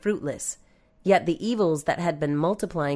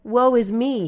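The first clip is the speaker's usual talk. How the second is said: higher, louder cry of woe; narrated cry, raised in pitch and volume; anguished cry; 6 semitones higher, 5 dB louder than usual. A narrator's reading. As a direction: cry it out as a narrator voicing a prophet. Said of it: "he cried".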